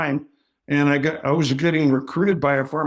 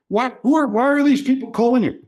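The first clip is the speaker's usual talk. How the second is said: much higher and louder, wife questioning; mock suspicious wife; 10 semitones higher, 4 dB louder than usual. Wife asking her husband why people keep calling, high mock voice. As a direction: pitch it way up as the nosy wife. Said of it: "She goes".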